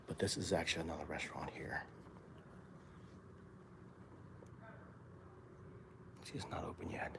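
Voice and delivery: hushed whisper in a quiet lobby; library whisper. Real, not acted. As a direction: whisper so you don't disturb anyone. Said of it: "I'm saying whispering because".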